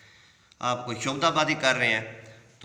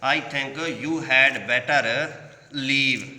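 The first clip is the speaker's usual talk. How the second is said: drawn-out slow reading of the line; teacher modelling how to read it; slow model reading; 4 dB louder, much slower than usual. Shown as a demonstration. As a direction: model the sentence slowly for students. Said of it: "آپ اس کو یوں پڑھ سکتے ہیں".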